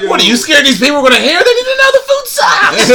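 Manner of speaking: hammed-up ranting regular; mock shout. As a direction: rant it like a crazy regular. George impression, shouting.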